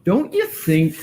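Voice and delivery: usual speaking voice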